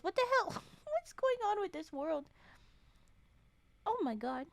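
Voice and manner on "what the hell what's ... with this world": high, incredulous what the hell; outraged disbelief. Real, not acted. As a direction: react in shocked disbelief. Outraged at the story.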